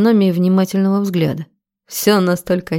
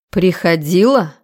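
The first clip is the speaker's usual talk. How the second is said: ordinary delivery, nothing marked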